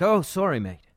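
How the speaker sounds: lightly put-on British accent, high start dropping on "mate"; hammed-up British apology. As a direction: try a rough British accent. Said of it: "my best impression of a, uh, a British accent".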